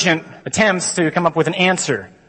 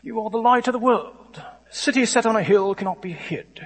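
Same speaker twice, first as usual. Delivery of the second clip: higher, softer Shakespearean stage English; stuffy Shakespearean accent; 6 semitones higher, 4 dB quieter than usual. Retold or imitated. Mimicking a film Jesus, stuffy Shakespearean accent. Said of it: "shows up with a Shakespearean accent".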